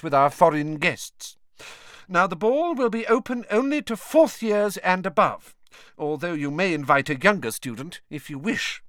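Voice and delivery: high-pitched, formal teacher addressing a class; stately and precise, pitched well up; formal teacher figure. A narrator's reading. Professor announcing the ball to her class, formal.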